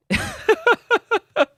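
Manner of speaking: high-pitched burst of laughter; high amused laugh. Real, not acted. Laughing at chat.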